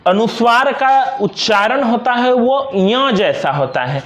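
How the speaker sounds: normal talking voice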